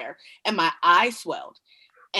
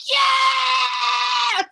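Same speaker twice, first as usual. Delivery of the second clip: cheering yes; 4 dB louder than usual. Retold or imitated. Son cheering the news.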